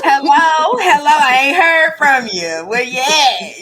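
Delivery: loud, high, slow calling out to a friend; mock check-in call. Voicing her check-in call.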